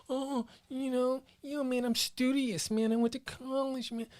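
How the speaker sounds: mocking studious guy. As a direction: imitate a smug student type. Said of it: "trying to act like I'm this".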